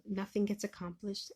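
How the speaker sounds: ordinary talk, nothing marked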